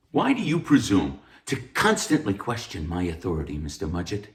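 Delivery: usual speaking voice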